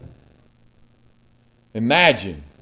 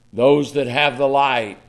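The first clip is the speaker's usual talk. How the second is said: ordinary talk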